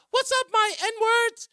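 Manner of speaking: high, loud pop star voice. Retold or imitated. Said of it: "[name] does a concert and says".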